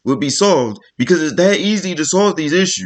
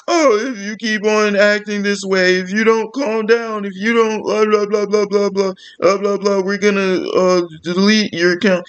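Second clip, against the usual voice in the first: higher, droning blah-blah mimicry; mock manic bank rep; 5 semitones higher than usual. Mimicking the bank person refusing to listen, mock manic voice. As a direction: imitate a frantic rep in a raised, running voice. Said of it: "having a manic fucking episode".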